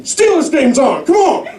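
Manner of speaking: high, fast, excited dad voice; old dad fixing the TV. Retold or imitated. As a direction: bang the TV like a dad before the game. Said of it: "expect him to be like".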